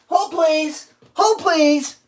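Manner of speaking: high, sing-song hold please; mock hold voice. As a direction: mimic the rep brushing you off. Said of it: "rep putting him on hold".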